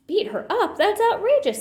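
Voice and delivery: high, loud outburst of disbelief; Papa bursting out in outrage; shocked fatherly outrage; outraged. A narrator's reading.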